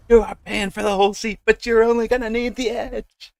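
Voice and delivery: very high, loud hype announcer; monster truck ad. Pretend. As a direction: sell it like a monster truck rally. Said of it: "monster truck announcer guy".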